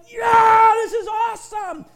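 big shouted "Yeah" then eased off; thrilled yeah. Retold or imitated. Preacher voicing a thrilled churchgoer, loud and excited. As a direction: act out a worshipper loving the moment.

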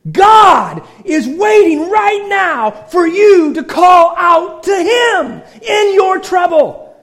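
preacher building to a climax, shouting; full-force preaching. Acted. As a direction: shout the appeal to the church.

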